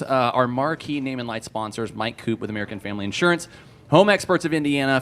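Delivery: usual speaking voice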